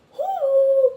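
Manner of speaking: very high loud whoop; cheer. Retold or imitated. Cheering her mom on, high loud whoop. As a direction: whoop like you're celebrating.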